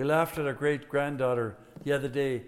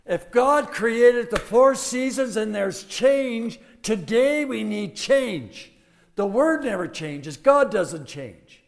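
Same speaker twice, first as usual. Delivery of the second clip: preaching at full force; 8 semitones higher, 11 dB louder than usual. Acted. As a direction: preach it out to the church.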